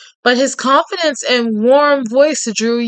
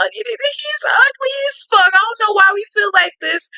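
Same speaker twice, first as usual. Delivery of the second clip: high incredulous squeal; 10 semitones higher than usual. Real, not acted. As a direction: voice shoots up in disbelief. Reader's outraged laughing comment.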